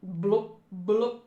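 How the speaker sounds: two short popping bloops; imitated bubbles. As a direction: pop out bubble noises.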